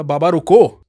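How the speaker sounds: put-on boy shouting after the walking man; shouting across the cattle; loud call. Boy calls a second time.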